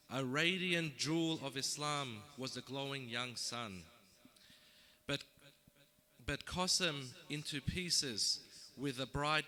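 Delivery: measured, declaimed verse with falling line ends; poem recitation. Acted. Poet reciting the story of Karbala to a gathering, solemn declamation. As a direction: recite it line by line, pausing after each phrase.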